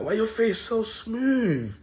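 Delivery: high, drawn-out feminine coo; imitated smitten woman. Woman crumbles looking at him, cooing high and slow. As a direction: put on a high, slow admiring voice. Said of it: "She turned into a female".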